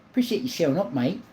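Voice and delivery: "Appreciate you showing up, mate" in a light put-on Australian accent; jokey Australian accent, half-hearted; mock Australian accent. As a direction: thank the viewer Aussie style, "mate" rising. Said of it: "Your accent is point on".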